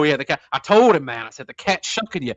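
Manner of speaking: a New Orleans lawyer's hip patter; Dean Andrews impression. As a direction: ham up a fast-talking hipster lawyer. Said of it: "Dean Andrews, who's played by John Candy".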